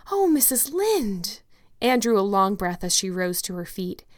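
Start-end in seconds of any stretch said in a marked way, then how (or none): 0.1-1.4 high, slow, breathless with hope; thrilled cry. Acted. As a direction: gasp out her name in delight. Anne thrilled by new hope.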